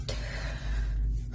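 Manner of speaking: a breathy sob-sigh; shaky crying breath. Real, not acted. Venting to viewers from the car, a crying breath.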